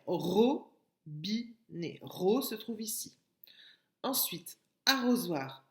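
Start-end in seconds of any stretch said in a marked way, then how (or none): 0.1-2.0 "robinet" in slow, separated ro, bi, né; syllable by syllable. Shown as a demonstration. Teacher locating ro for pupils, "robinet" slowly syllable by syllable. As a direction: pause after each syllable of "robinet". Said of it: "La syllabe ro se trouve".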